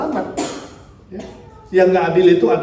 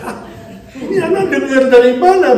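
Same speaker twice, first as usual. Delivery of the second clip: surprised; 8 semitones higher, 7 dB louder than usual. Retold or imitated. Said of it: "Kaget saya".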